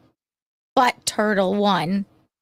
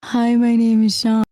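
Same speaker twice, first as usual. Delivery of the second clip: monotone, deadpan introduction; boring voice; 3 dB louder than usual. Shown as a demonstration. Asked to sound boring, a flat deadpan hello. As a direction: say it as dull as you can. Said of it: "tell me how my boring voice should sound".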